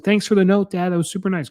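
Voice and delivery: put-on excited child. Daughter thanking dad for his note, imitated excitement. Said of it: "She was so excited".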